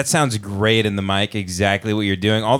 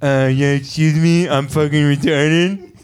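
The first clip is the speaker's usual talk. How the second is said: dopey pop star voice; 4 semitones higher than usual. Pretend. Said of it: "they come out and they're like".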